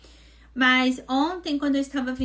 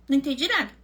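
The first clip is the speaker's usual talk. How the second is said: ordinary talk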